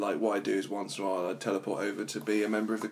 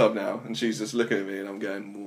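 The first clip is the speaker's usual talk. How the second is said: ordinary talk, nothing marked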